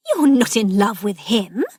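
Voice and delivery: mildly scandalised, pushing the question out; shocked voice. A narrator's reading. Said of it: "in a shocked voice".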